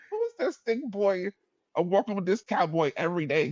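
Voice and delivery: high, put-on gossiping voice; nosy onlookers. Classmates gossiping about the odd pair, high mock voice. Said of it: "I'm sure people were like".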